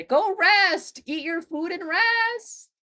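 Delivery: very high, slow sing-song. Pretend. Teasing about resting.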